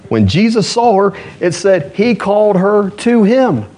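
high, ringing sermon voice; fiery preaching. Acted. Pastor preaching to the church about healing, fiery. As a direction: preach it to the congregation with full fire.

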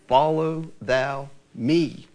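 very slow, stretched words; slow drawn refrain. Acted. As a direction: draw out each word like a calling.

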